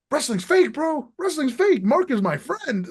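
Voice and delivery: high, exasperated retelling, loud on fake; telling the story of his Twitter ban, high and indignant; retold protest. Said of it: "I was like".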